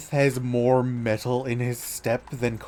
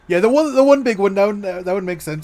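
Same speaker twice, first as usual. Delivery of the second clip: loud, high, fast agreement; excited; 8 semitones higher, 9 dB louder, faster than usual. Real, not acted. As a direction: jump in eagerly. Emphatic agreement.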